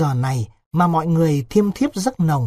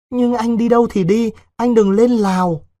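put-on high, louder anxious young woman; pleading village girl; 7 semitones higher, 4 dB louder than usual. Vịnh asks Đối why he must go to Laos, pleading. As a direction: plead with him in a raised, higher voice.

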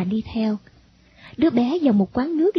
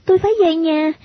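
put-on high-pitched child's voice, louder at the end; little girl refusing; 9 semitones higher, 4 dB louder than usual. Girl refuses to follow stranger.

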